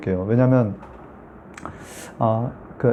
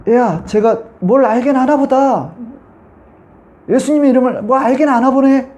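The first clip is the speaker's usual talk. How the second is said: high, slow, strange-timbred demon taunt; 9 semitones higher than usual. Retold or imitated. Demon speaking through the woman to the preacher, eerie high taunt. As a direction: sneer in an eerie put-on demon voice. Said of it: "그 귀신이 하는 얘기가".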